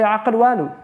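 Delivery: quoting a Moroccan Arabic proverb in Arabic sounds; Moroccan Arabic saying. Retold or imitated. Teacher quoting Moroccans to students, Arabic pronunciation. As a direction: say "Aql walu" the Moroccan way, guttural q. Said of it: "like the Moroccans say".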